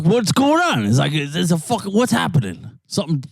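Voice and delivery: mock confused. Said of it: "They're like".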